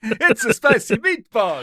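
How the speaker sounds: put-on slow, hammy Italian accent; mock Italian accent. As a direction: ham up a cliché Italian. Jokey Italian stereotype line.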